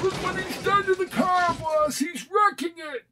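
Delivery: high alarmed yelling; alarmed shout. Acted. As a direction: cry out in panic, high. Henchman shouts about wrecked car.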